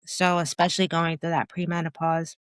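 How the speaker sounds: usual speaking voice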